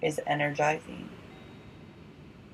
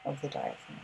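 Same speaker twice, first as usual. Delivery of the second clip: guided yoga instruction; 4 dB quieter, faster than usual. Acted. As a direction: guide the breathing gently, unhurried.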